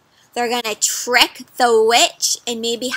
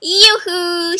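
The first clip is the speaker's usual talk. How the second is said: higher sing-song call; 6 semitones higher, 4 dB louder than usual. Acted. Witch calling across the meadow, higher. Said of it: "she shouted".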